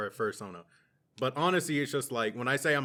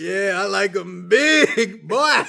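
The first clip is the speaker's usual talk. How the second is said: high, very loud, drawn-out holler; hammed-up lusty shout; 8 semitones higher, 13 dB louder, much slower than usual. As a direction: draw out BIG in a high, over-the-top yell. Acted reaction to big cartoon elephant.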